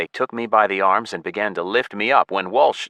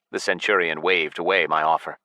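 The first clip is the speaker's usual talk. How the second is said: ordinary delivery, nothing marked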